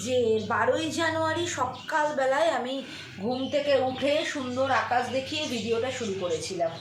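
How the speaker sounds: subdued, low-key chat at home; quiet confiding to viewers; hushed talk. Real, not acted. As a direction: keep it low so the house stays calm.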